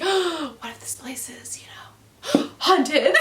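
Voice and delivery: mock the melodramatic characters; overacted horror whisper with gasps; hammed-up spooky gasp. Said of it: "That's literally how they talked".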